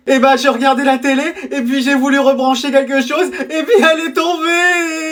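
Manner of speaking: put-on whiny child building to a wail; badly faked sobbing to win sympathy; fake crying. Little brother fakes tears to mother.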